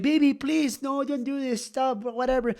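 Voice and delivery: high, slow begging woman's voice; pleading girlfriend. Retold or imitated. Girlfriend begging him to stop.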